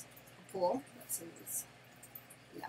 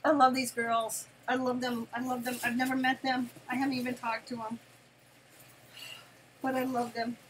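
gushing love; 4 semitones higher, 6 dB louder than usual. Real, not acted. Gushing about sellers.

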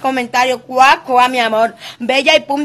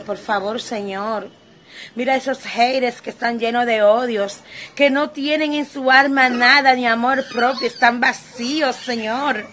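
lower, much softer, hushed pleading prayer; mock prayer; 3 semitones lower, 4 dB quieter than usual. Vlogger praying for her haters in a soft, hushed mock-devout murmur.

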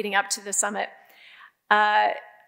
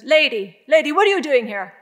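loud, raised, incredulous challenge; louder mock-gruff challenge to an intruder; challenging older colleague; 4 semitones higher, 7 dB louder than usual. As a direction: call her out sharply, imitating him. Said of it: "he's a complete character".

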